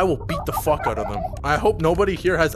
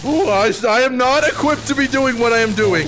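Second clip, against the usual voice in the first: melodramatic speech; 7 semitones higher, 6 dB louder than usual. Acted. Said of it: "give a melodramatic speech".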